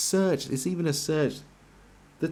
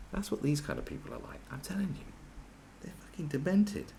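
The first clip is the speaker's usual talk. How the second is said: much softer, confiding under the breath; hushed warning; 2 semitones higher, 8 dB quieter than usual. Real, not acted. Conspiratorial hushed warning about techies.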